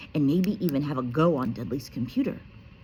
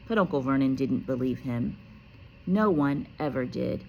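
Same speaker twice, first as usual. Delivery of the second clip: usual speaking voice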